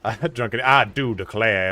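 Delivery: slow, exaggerated Southern US drawl; mock Southern drawl. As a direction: put on a hammed-up Southern drawl. Mimicking the drunk Southern friend.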